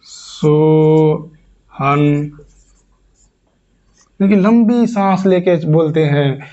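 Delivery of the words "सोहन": the vowel stretched very long and slow on one breath; long drawn-out call. Shown as a demonstration. Teacher demonstrates a prolonged call of Sohan. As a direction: hold the name out as if calling someone far off. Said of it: "इस तरीके से चिल्लाते हैं".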